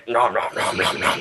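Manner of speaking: cartoonish munching nom-noms; gobbling noises. Guest wanting a buffet of food, mock gobbling. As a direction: playfully act out stuffing your mouth.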